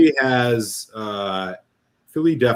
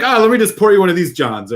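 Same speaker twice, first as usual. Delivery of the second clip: higher, louder quoted local; lively imitation of a local; mock Philly local; 8 semitones higher, 5 dB louder than usual. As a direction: lightly act out a casual slang user. Acting out casual Philly slang users.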